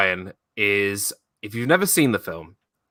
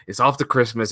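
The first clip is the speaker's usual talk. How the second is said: normal talking voice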